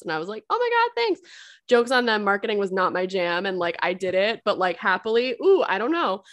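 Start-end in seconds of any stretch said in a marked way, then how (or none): 0.5-1.2 squealed much higher, gushing gratitude; high, over-the-top grateful squeal; mock gushing thanks. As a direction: gush it like an overjoyed new hire. Retold grateful reply to bosses.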